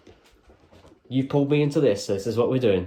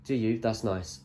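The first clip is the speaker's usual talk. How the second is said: ordinary talk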